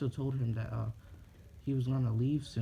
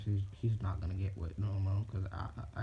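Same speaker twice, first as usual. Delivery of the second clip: plain reading voice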